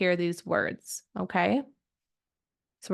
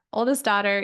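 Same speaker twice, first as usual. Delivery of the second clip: usual speaking voice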